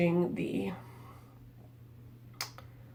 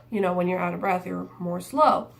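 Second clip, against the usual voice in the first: normal talking voice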